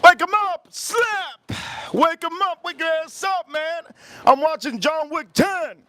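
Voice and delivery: hammed-up screaming rant; shouting. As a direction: yell to rouse a sleeper at the movies. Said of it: "I went off the Richter scale there".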